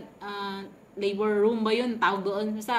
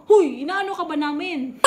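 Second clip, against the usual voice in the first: higher, louder scolding snap; 6 semitones higher, 8 dB louder than usual. Retold or imitated. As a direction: scold the noisy patient. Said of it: "pinagalitan ako".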